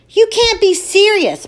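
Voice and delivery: high, loud disbelief; exclaiming. Acted. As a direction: cry out in shock. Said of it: "my mother exclaims".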